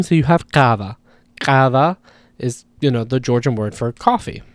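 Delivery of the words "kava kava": kava with a strong, throaty popped first k; strong ejective. Shown as a demonstration. Teacher giving Georgian for coffee, kava with strong ejective k. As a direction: start kava on a hard uvular ejective. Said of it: "it stays relatively strong".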